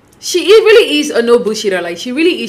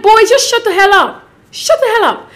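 exasperated outburst; 9 semitones higher than usual. Real, not acted. Vlogger yelling at Jeremy on screen, exasperated and high. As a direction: snap at him to shut up, pitch high.

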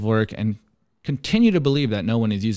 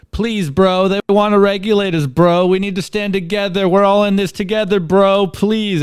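higher, louder mock begging to a bro; mock whiny pleading; 5 semitones higher, 5 dB louder than usual. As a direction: whine it like a desperate crypto bro. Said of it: "And he's crying over here".

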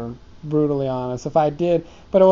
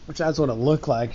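ordinary talk

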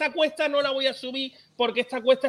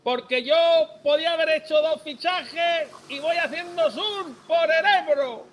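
mock maño accent, higher and slow; mock Aragonese accent; 6 semitones higher, slower than usual. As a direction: ham up a maño accent, drawn out. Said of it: "con el acento que toque". (acento maño fingido, más agudo y lento)

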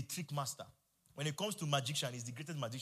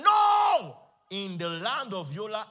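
defiant shout; 6 semitones higher, 12 dB louder, slower than usual. Shown as a demonstration. Preacher shows the church how to rebuke Satan, defiant shout. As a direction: shout the no at full power, then slow and loud.

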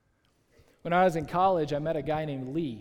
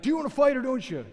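high, loud, quick mock outburst; huffy challenge; 8 semitones higher, 6 dB louder, faster than usual. Kickboxer to his dodging opponent, huffy mock challenge. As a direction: imitate an exasperated fighter demanding action, pitched way up. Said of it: "he's all huffy".

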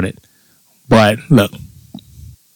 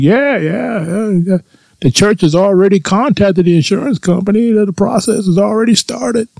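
high-pitched, chirpy imitation of a pleased salesman; mock the guy's giddy glee, pitched way up; gleeful insurance guy; 11 semitones higher than usual. Imitating the gleeful insurance guy telling about the payout, high and chirpy. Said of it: "gleeful the way he was talking about that".

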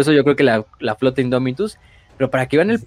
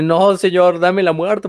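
mock crying plea; 6 semitones higher, 4 dB louder, slower than usual. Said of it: "así llorando de".

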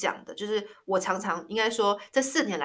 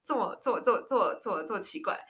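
higher, giggly mock cringe; recalling an over-sweet pet name, mock cringe; amused disgust; 5 semitones higher than usual. Real, not acted. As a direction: act grossed out but amused. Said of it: "这太恶了吧".

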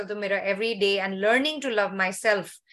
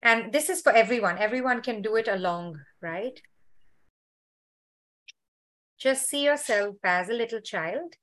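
guided meditation; 2 semitones lower than usual. Acted.

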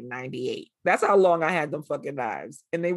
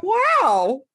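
high, loud, wide-eyed wow; amazed wow; 13 semitones higher, 9 dB louder than usual. Retold or imitated. Talking about new knives, an amazed wow. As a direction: gasp out a wow at the sharp knife. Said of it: "I was like".

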